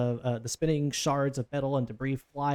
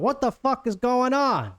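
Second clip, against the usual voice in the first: much higher, louder, drawn-out New Jersey yell; shouted demand; 11 semitones higher, 6 dB louder, slower than usual. Acted. Boss woman shouts out at her crew, irate yell. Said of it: "So she shouts out".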